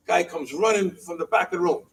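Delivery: ordinary talk, nothing marked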